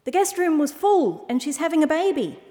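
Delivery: high-pitched, pleading donkey voice; donkey character. A narrator's reading. Donkey explaining to the owl, high and hurried. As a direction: explain it urgently in a high animal voice.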